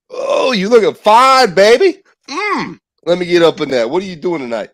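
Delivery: hammed-up street pickup, laid on thick; catcalling man. Street catcaller hitting on a passing woman, hammed up.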